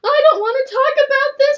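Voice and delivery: high, loud panicked squeal. Retold or imitated. Retold panic before proposal.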